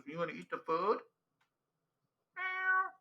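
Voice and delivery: high, soft cooing voice for the cat; talking to the cat in a silly high voice. Real, not acted. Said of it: "I talk like that 'cause it annoys my girlfriend".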